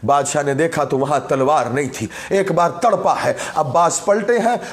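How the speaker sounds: subdued majlis lament; low grieving narration. Real, not acted. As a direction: tell it low like a broken mourner.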